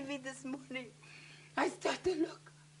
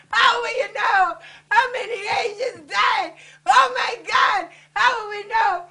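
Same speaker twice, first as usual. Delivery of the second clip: loud plea building to a wail; anguished outcry; 3 semitones higher, 8 dB louder than usual. Real, not acted. Mourner demanding answers about the dead and babies, anguished outcry. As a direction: cry out louder and louder in grief.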